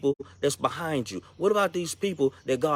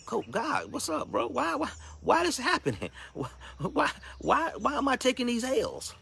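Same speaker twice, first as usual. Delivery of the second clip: much higher, softer whiny plea; pleading with God; 10 semitones higher, 4 dB quieter than usual. Retold or imitated. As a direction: whine upward at God, half-laughing. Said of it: "If you ask God".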